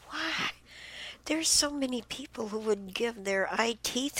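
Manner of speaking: high-pitched, distressed disbelief; upset and on the verge of tears; close to crying. Real, not acted. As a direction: plead in real anguish, voice lifted high. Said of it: "I'm gonna start crying".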